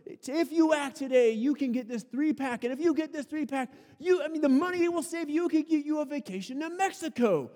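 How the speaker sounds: high, pushy sales voice; infomercial pitch. Retold or imitated. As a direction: hype up the deal. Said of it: "he starts to tell us".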